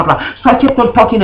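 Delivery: ordinary talk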